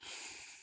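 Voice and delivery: long weary sigh; heavy sigh. Real, not acted. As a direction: let out a tired sigh. Sad about dying dogs.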